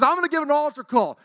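high, loud imitated preaching; revival preacher. Evangelist addressing a revival congregation, imitated forceful preaching. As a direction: proclaim it like a fired-up evangelist. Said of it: "this man was up there preaching".

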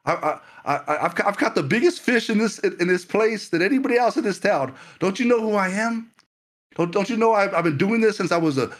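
high, cocky know-it-all Peter scoffing at Jesus; proud Peter. Acted. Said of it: "Peter could have said".

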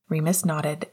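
ordinary delivery

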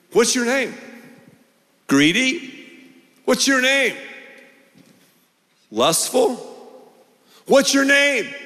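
pressing question. Acted. God pressing the question repeatedly.

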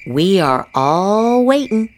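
normal talking voice

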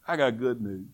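very low and soft; hushed announcement. Acted. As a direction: drop right down to a confiding undertone. Sudden drop after the shout.

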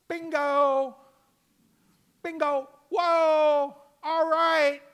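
high, loud "Bingo!" like a game-show win; excited shout. Real, not acted. Preacher cheering Peter's confession to the congregation, loud excited shout.

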